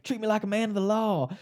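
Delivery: Southern twang, pitched well up, stressing like. Retold or imitated. Podcaster explaining the emphasis, Southern twang on the line. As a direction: quote Dewey's line with a hammed Southern lilt. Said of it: "a little bit of Southern twang when he delivers that line".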